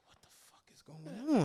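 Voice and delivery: hushed, voiceless disbelief; whispered aside. Retold or imitated. Telling friends how he whispered on the curb, a whisper. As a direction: breathe it out under your breath, unvoiced. Retells his own hushed reaction.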